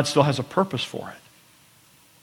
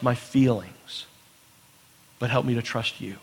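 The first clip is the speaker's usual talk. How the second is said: quiet prayer; 6 dB quieter than usual. Pretend. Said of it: "maybe your prayer is this".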